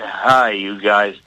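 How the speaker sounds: half-hearted American drawl, slowed down; John Wayne voice attempt. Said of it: "I can't do a John Wayne accent".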